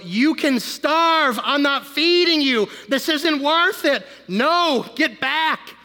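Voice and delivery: high, loud, slow defiant rebuke; defiant rebuke to the devil. Acted. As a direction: order the devil off, forceful and slow. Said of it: "We gotta tell the devil".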